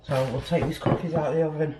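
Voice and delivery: puffing after shoveling snow; breathless, catching her breath. Real, not acted. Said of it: "bear with me one minute, get my breath back".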